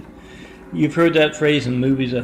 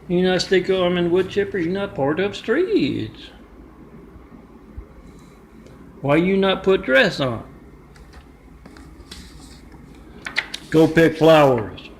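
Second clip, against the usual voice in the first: mock angry scolding; 5 semitones higher than usual. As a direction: berate him like an angry girlfriend in a put-on accent. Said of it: "yells and screams at him".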